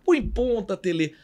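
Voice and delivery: high-pitched fanatical fan's plea; Zé da Galera. Retold or imitated. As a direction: imitate a fan calling out to the coach. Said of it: "Lembra aquele torcedor fanático?". (torcedor fanático imitado, voz aguda)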